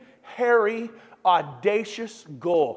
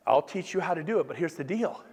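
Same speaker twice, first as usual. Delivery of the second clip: ordinary delivery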